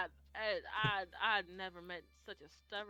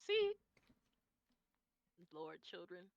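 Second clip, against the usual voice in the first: ordinary delivery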